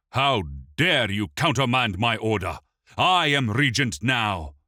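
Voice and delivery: loud, high furious challenge. A narrator's reading. Regent turning on the steward in a fury. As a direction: rage at the steward. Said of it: "turned on the steward in a fury".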